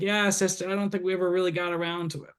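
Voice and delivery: a quick, lightly voiced Boston dad brushing it off; imitating his dad's thick Boston accent. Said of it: "he has a thick Boston accent now".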